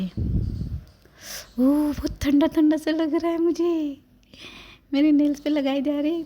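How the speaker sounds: put-on high, squeaky baby voice at the cold paint; cold baby squeal. Baby feeling cold colour on her feet, high squeaky voice.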